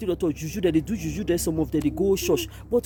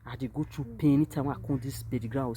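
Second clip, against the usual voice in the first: normal talking voice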